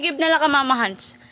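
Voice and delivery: normal talking voice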